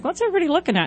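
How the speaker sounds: high-pitched curious dog voice; voicing the dog. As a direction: pipe up as a puzzled dog. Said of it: "Recon's like".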